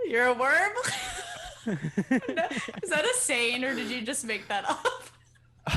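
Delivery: bursting into real laughter at the question; laughing disbelief. Real, not acted. Host hearing the worm line, breaking into laughter.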